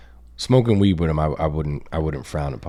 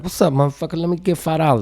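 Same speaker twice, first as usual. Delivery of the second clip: street-corner mom; 7 semitones higher than usual. Retold or imitated. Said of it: "sometimes she comes around the corner".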